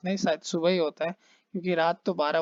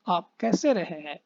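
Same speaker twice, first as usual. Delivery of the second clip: normal talking voice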